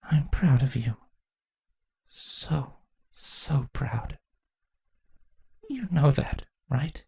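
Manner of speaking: soft, faltering, near tears; proud words that barely hold together; shaky voice. Acted. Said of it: "Chrysalis asked shakily".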